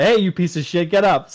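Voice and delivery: army bark. Retold or imitated. Soldier rousing the next guy, loud rough encouragement. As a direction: bark it like a gruff comrade, no real malice. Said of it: "runs to the next guy".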